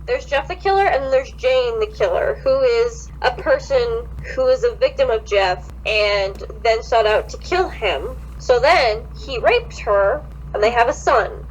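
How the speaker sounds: normal talking voice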